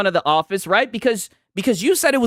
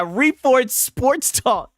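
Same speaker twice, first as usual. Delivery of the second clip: sports talk voice; 6 semitones higher, much slower than usual. Retold or imitated. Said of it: "sports commentary voice".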